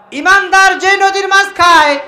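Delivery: high, loud proclamation; preaching burst. Acted. Preacher proclaiming to the crowd, high and loud. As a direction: ring it out to the crowd.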